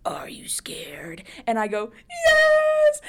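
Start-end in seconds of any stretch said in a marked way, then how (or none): 0.1-1.2 slow, soft creepy clown whisper. Retold or imitated. Clown leaning over her shoulder to scare her, creepy whisper. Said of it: "at first his voice was like". 2.1-3.0 high, loud wailing scream; sobbing scream. Retold or imitated. Scared thirteen-year-old answering the clown, high sobbing scream. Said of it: "like, sobbing".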